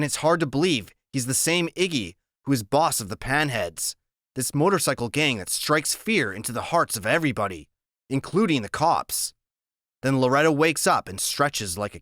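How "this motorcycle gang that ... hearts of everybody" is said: ordinary delivery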